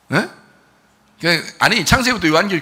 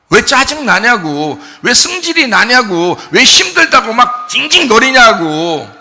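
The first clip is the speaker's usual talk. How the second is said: loud, high, drawn-out rebuke from the pulpit; impassioned preaching; 8 semitones higher, 7 dB louder, slower than usual. Acted. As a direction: scold the congregation at full force. Preaches at full force.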